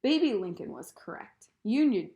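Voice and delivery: ordinary delivery, nothing marked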